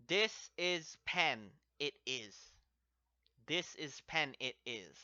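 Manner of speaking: "This is pen, it is" said slowly, dropping at "it is"; literal slow breakdown. Shown as a demonstration. Teacher breaks the sentence down slowly for students. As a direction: spell out the literal order slowly. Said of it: "break it down as".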